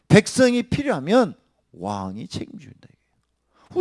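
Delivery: 책임져 declared loudly; loud preaching. Real, not acted. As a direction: call it out to the hall. Loud repeat after whisper.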